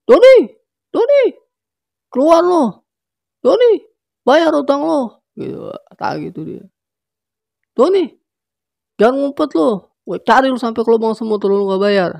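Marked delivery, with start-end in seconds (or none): 0.1-5.2 much higher, loud shouts; yelling. Acted. Rusman yelling at Doni to come out and pay: loud shouting. Creditor yelling at debtor's house. 7.8-12.1 higher, loud angry shout; yelling. Acted. Rusman yelling at hiding Doni to pay: loud shouting. Creditor yelling at debtor's house.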